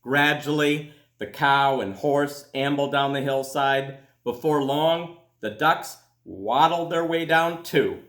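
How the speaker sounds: put-on gentle storybook reading for children; calm read-aloud to kids; story narration. A narrator's reading. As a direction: read it like a bedtime picture book. Reads a picture book aloud.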